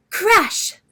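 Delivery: shouted sound effect crash; booming thunder crash; loud crash. A narrator's reading. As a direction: bang it out like thunder. Storm sound effects in story.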